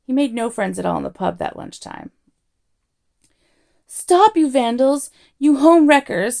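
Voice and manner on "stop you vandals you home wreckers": loud, high-pitched outraged bawl. A narrator's reading. Arthur yelling at the men wrecking his house, bawling. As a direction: bawl at them at full volume. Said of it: "bawled Arthur".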